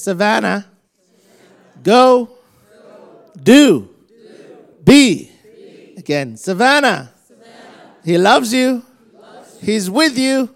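loud, high, ringing proclamation; shouted blessing. Real, not acted. Preacher shouting a blessing over Savannah. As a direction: cry it out over her.

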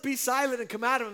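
high, loud, quick brush-off command; lightly voiced breezy exorcist; mock easy command. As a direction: toss off the rebuke too easily. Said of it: "So it wasn't".

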